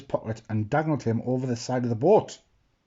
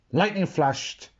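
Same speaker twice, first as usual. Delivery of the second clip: plain reading voice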